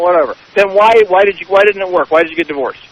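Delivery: ordinary talk, nothing marked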